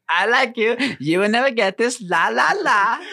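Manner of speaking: high, hammed-up foreign comic voice; gleeful mock accent, well above own pitch; Borat. Joining a friend's impression, a high Borat voice. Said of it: "I can only do fucking Borat's voice".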